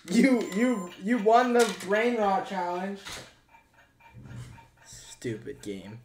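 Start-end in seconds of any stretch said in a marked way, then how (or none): 0.1-3.0 mock celebration.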